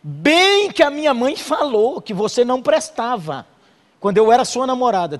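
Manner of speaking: hammed-up wife throwing an old grudge; nagging wife. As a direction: play the bitter wife bringing up her mother. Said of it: "a mulher diz assim".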